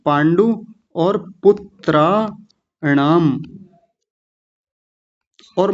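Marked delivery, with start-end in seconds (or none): 0.1-3.4 पांडु and पुत्राणाम said slowly as two parts; split word. Shown as a demonstration. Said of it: "अलग अलग करके".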